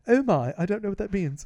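put-on touch of a prim British accent; mock British accent. Imagining puzzled London listeners, in a mock British accent. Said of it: "the people in London are going".

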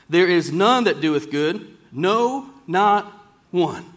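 slow, solemn reading of scripture, pausing on none. Acted. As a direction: read the verses deliberately, leaning on each none. Reads scripture slowly, emphatic pauses.

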